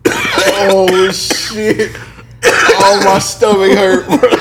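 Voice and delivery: after laughing hard. Real, not acted. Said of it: "Why are you laughing at that?".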